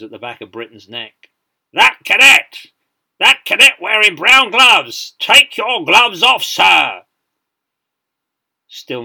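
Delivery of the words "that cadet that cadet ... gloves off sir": slow, very loud, high parade-ground roar; exasperated shouted order. A narrator's reading. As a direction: hammer out each word at an unresponsive cadet. Ignored order shouted once more.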